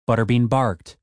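plain reading voice

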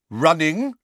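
loud, high, sharp echo. A narrator's reading. Judge picks up the witness's mistake, sharp. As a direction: throw the word back sharply, questioning it. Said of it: "said Madam Bones sharply".

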